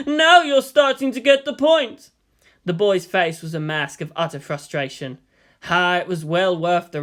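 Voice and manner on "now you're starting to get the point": loud, high laughter into words; laughing. Acted. Yang laughing at frustrated Shinji, amused. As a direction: chuckle with amusement at his misery.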